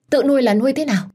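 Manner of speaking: a mother aghast at her son, voice jumping up; mẹ chồng sửng sốt, mắng cao giọng; shocked scolding. Acted.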